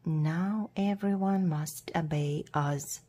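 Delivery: ordinary delivery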